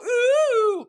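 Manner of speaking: very high swooning ooh; bee's swoon. Retold or imitated. Wife voicing the bee enjoying floral deodorizer, high swoon. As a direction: voice the bee smelling flowers. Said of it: "It's all".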